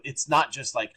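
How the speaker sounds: ordinary delivery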